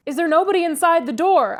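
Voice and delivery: high, loud calling out; hallooed. A narrator's reading. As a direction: halloo to someone behind a door. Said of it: "I hallooed responsively".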